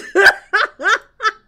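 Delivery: loud high burst of laughter. Real, not acted. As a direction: howl with laughter. Laughing at Tommy Lee Jones joke.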